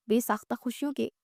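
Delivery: ordinary delivery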